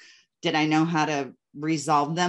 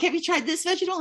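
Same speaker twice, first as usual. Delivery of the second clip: much higher playful mock coach voice; coach quoted; 13 semitones higher, much faster than usual. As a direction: ask it like a teasing imitation of the coach. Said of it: "And you would be like".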